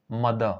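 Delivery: मदर said as "muh-dur", the द sounded with its अ; pronunciation model for the class. Shown as a demonstration. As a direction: read मदर out slowly and clearly as a teacher. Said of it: "अब इसको पढ़ते कैसे हैं?".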